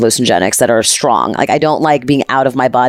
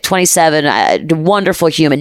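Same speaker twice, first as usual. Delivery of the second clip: ordinary talk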